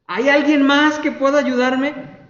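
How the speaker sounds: loud, high-pitched shout; acted cry for help. Hanging man seeks another rescuer after the silence, acted shouting. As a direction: holler out for anyone else. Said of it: "y gritando".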